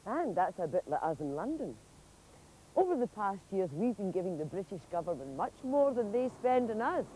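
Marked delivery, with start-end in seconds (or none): none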